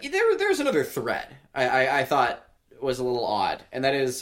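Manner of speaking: usual speaking voice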